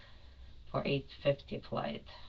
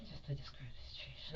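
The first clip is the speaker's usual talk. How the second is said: soft unvoiced whisper; whispered aside; 14 dB quieter than usual. Real, not acted.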